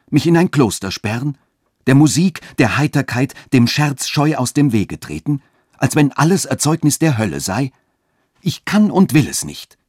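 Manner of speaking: höher und lauter, empört; unwillig. A narrator's reading. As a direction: sich empört zur Wehr setzen. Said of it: "nicht ohne einigen Unwillen". (Katharina weist Friedrichs Vorwurf unwillig zurück, höher und lauter)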